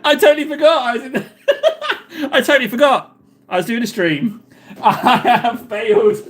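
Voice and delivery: words broken up by laughing fits; helpless laughing. Real, not acted. Streamer admits he forgot the stream, laughing helplessly. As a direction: confess it through real laughter.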